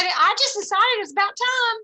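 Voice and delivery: retelling a senior colleague, lightly voiced; spunky old lady. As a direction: play the seventy-something director who just decided. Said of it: "She said".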